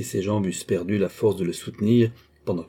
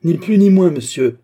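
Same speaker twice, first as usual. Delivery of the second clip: high, loud, emphatic retort; defiant retort; 7 semitones higher, 9 dB louder than usual. A narrator's reading. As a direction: throw it back forcefully, pitched up. Defiant retort in acted quarrel. (réplique de défi, forte et aiguë)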